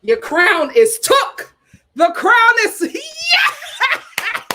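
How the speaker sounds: gloat, then burst out laughing; triumphant laughing shout. Real, not acted. Cheering crown moment.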